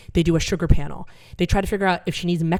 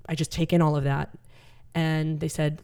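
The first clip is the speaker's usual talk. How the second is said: normal talking voice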